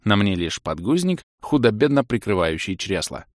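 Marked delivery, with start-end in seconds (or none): none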